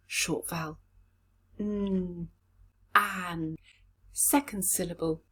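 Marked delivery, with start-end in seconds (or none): 1.6-2.3 the consonant "N" held alone as a hum; isolated sound. Shown as a demonstration. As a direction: hum out "N" by itself. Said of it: "let's look at the sounds".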